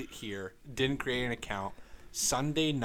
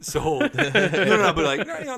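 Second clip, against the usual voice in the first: high, loud laughing protest; 9 semitones higher, 11 dB louder than usual. Real, not acted. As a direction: laugh through the denial. Laughing while admitting a dating choice.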